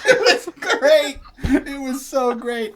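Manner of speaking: high laughing delight. Real, not acted. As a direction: laugh through the words. Laughing after the prank story.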